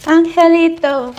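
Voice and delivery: high, loud, slow cooing; drawn-out sympathetic coo; cooing pity. Acted. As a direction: coo it like pitying a pet. Pitying cooing at the angel.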